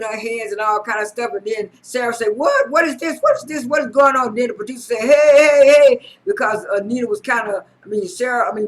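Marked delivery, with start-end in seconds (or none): 2.4-4.3 high, loud, startled "what is this"; alarmed outburst. Retold or imitated. Retelling Sarah's alarmed outburst. 5.0-6.1 loud, high "hey, hey" to break it up; calling out to stop. Retold or imitated. Producer breaking up the fight.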